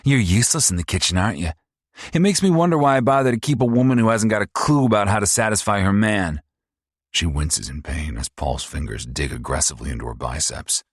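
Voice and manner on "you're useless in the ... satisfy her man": high, loud, fast mocking sneer; sneering abusive son. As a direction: taunt her like a cruel bully. Narrator voices abusive son Paul.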